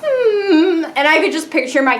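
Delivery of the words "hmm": higher, haughty hum like a proud cat; smug cat hum. Retold or imitated. Persian acting smug, a haughty cat hum. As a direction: purr out a disdainful hmm. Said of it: "It also was just like".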